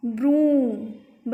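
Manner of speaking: "ब्रूम" very slow, oo held long; teacher drawing out the vowel in "ब्रूम"; long OO sound. Shown as a demonstration. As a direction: stretch "ब्रूम" into brooom. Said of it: "बड़े ऊ की ऊम".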